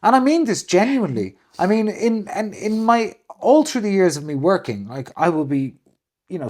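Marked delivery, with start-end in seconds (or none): none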